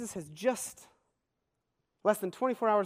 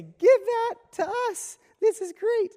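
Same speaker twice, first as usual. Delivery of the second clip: much higher, louder, slow gushing excitement; eager crowd; 13 semitones higher, 6 dB louder, slower than usual. Acted. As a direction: beg like a greedy, delighted crowd. Crowd eagerly asking for the bread.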